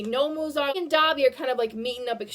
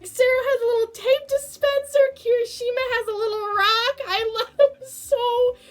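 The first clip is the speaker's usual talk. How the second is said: high, bubbly fangirl gush; gushing over cuteness; 9 semitones higher than usual. Real, not acted. Fan gushing about the Christmas costumes, high cooing. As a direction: coo over every tiny detail.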